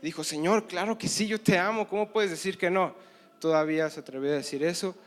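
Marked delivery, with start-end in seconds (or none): 0.2-2.9 raised, loud, frustrated insisting; exasperated. Acted. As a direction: push back, hurt and fed up. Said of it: "como que se desesperó".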